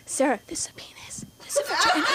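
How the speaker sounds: hushed whisper from the next stall. Retold or imitated. Big sister whispering a secret through the stall. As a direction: breathe it secretly, no voice. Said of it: "she's like".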